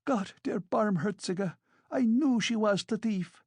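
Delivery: high-pitched indignant exclamation; outraged cry. A narrator's reading. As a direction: cry out in scandalised vindication. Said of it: "cried Amalia Ivanovna".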